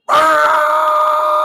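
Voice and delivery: loud, high, sustained honk; imitated car horn. Dad leaning on the horn at driver, long vocal honk. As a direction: blare like a horn held down. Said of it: "he just sat on that horn".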